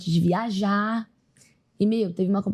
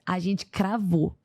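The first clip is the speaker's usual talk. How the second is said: slow emphatic; much slower than usual. Real, not acted. Proud landing of the routine.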